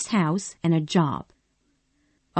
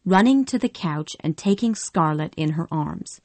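plain reading voice

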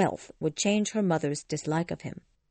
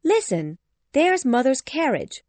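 high, loud announcement; eager, pitched up; excited cry; 7 semitones higher, 5 dB louder than usual. A narrator's reading. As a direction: call out with sudden excitement. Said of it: "cried Jane".

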